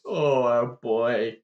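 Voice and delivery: theatrical groaning grief; pained lament. Acted. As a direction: drag out the anguish, hammed-up gnome father. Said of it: "convulses in pain and says".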